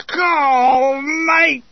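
high, drawn-out agonised yell; acted yelp of agony; cry of pain. A narrator's reading. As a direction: howl it as the burning hits. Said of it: "was rapidly echoing all around".

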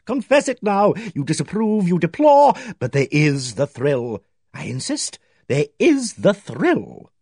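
high, rising coaxing voice, slowing on the thrill; teasing insistence. A narrator's reading. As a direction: tease the lady into admitting it. Pye teasing Miss Barton.

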